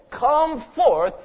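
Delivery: loud, high summons; powerful command. Acted. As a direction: command it as if opening every grave. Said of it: "word of God is so powerful".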